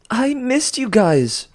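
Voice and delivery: a mock-sweet, drawn-out greeting; grand announcement. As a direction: announce it sweetly to the whole cabin. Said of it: "Piper announced".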